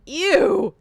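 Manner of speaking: loud high ew; disgusted ew. Real, not acted. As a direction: recoil in disgust. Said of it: "disgust at Okies".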